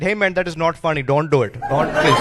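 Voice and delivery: imitated Indian accent, pleading protest. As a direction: scold politely in a mock Indian accent. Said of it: "I do do the Indian accent".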